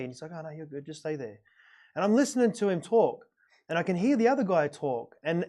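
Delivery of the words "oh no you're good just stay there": calm reassurance. Retold or imitated. Rich guy reassures him calmly.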